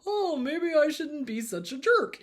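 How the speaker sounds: high, mocking voice of a changed abuser; lightly mocking self-aware jerk; mock abuser realizing. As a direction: mimic the jerk's fake epiphany.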